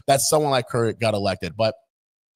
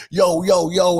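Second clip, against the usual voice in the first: mock street greeting; 5 semitones higher, 6 dB louder, slower than usual. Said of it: "being that".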